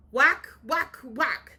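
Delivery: punchy, loud axe blows; chopping sound words, hammed up loud; loud whacks. A narrator's reading. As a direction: smack each whack out hard and high. Axe striking tree in story.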